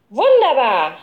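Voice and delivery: high, drawn-out cheer; delighted cry. A narrator's reading. Boy cheering his friend's plan, delighted cry. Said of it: "schrie Gerold".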